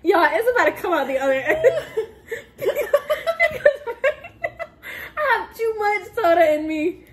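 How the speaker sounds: high, squealing laughter through the words; real giggling, nearly crying with laughter; laughing helplessly. Real, not acted. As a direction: talk while cracking up. Laughing helplessly after burping.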